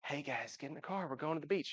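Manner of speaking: a hint of a tired parent waking everyone; sleepy early-morning voice. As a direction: mumble it like it's five a.m. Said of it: "and then it's like".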